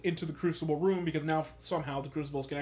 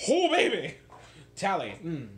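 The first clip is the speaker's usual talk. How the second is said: delighted gush; 3 dB louder than usual. Real, not acted. Gushing over a favourite character.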